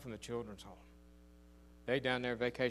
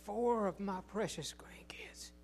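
whispered; 4 semitones higher, 3 dB quieter than usual. Acted. Tender aside in sermon.

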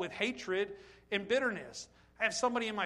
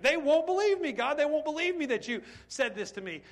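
much higher and louder pleading; desperate protest; 8 semitones higher, 6 dB louder than usual. Retold or imitated. Moses begging God, high and loud. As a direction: cry out the objection to God. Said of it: "He said to them flat out".

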